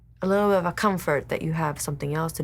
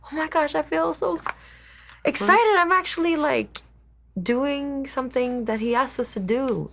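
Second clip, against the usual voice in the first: high, thrilled gush of delight; excited; 8 semitones higher than usual. Retold or imitated. Retelling to listeners how thrilled she felt, high excited voice. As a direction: squeal it like a giddy convert. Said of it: "how I felt like".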